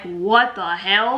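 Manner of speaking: slow, stretched-out incredulity; exasperated, half-speed reaction; retold disbelief. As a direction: drag it out, recalling your bafflement. Recounts reaction to seizure diagnosis.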